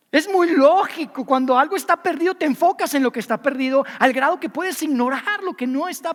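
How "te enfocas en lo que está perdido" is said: ordinary delivery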